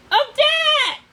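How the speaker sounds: loud, high booming shout; villain calling out. A narrator's reading. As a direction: call her from far away. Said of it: "Rothbart called again".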